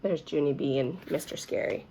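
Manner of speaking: usual speaking voice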